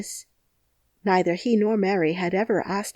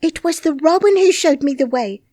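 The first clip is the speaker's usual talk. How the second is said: higher, louder stubborn insistence; obstinate protest; 8 semitones higher, 7 dB louder than usual. Acted. Mary stubbornly insists to Ben, higher and louder. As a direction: dig in and refuse to back down. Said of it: "she protested obstinately".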